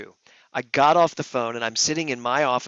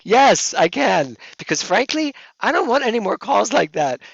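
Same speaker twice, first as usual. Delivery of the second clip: high, loud comic exclamation; hammed-up emphatic concession; emphatic agreement; 10 semitones higher, 8 dB louder than usual. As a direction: give in at once, loudly and high. Acts emphatic agreement, joking after laugh.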